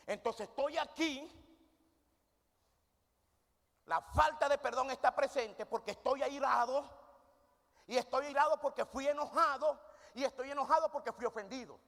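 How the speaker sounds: high, loud preacher's climax; rising preaching. Acted. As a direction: hammer the chain of causes home. Preacher climaxes the chain argument. (predicación en clímax, aguda y fuerte)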